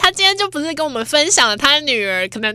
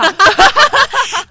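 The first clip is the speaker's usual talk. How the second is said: higher, louder falling laugh; hearty laugh; 11 semitones higher, 8 dB louder than usual. Real, not acted. Host laughing at her mother's saying, loud.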